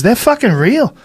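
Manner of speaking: hammed-up Australian, climbing high; mock Australian, high and insistent; Adam Green impression. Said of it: "worst Adam Green impression".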